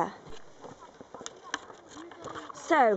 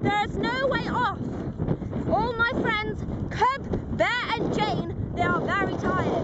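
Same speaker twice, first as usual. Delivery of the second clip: documentary narration; 2 semitones higher than usual. Acted. Dramatic survival documentary narration.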